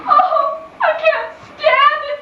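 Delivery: high, wailing cry of despair; hysterical outburst. Acted. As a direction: shriek it out as if at the end of her rope. Hysterical sister-in-law breaks down.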